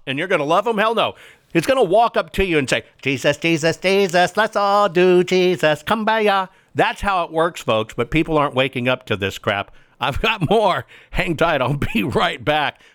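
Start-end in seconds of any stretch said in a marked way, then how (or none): 3.0-6.5 the devil luring with a sweet pious chant; mocking devil.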